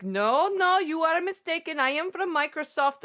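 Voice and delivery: high, sugary call-center politeness; scam caller voice. Retold or imitated. Retelling the scam caller's sweet reply in a high voice. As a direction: lightly mimic an overly polite scammer. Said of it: "And she's just like".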